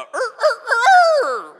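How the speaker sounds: loud, very high rooster crow; rooster crow imitation. Shown as a demonstration. Pastor crows like a real rooster for the laughing congregation, loud and high. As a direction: throw your head back and crow like a rooster. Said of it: "I got claps for imitating a rooster".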